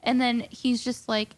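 ordinary talk, nothing marked

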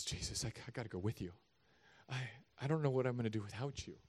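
soft, low, hushed plea; quiet plea. Acted. Healed man begging Jesus to take him along, softly. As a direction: plead softly to stay with him.

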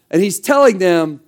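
ordinary talk, nothing marked

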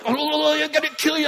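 high, wailing demon imitation; hammed-up eerie killer voice; possessed threat. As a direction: wail the threat on a held high pitch. Possessed man's threat, acted out.

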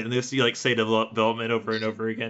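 ordinary talk, nothing marked

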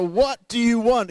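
high, loud, slow romantic-movie plea; pleading husband quoting a movie, high loud shout; Notebook movie shout. Retold or imitated. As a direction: belt it like a lovesick film hero. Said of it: "He's seen the Notebook".

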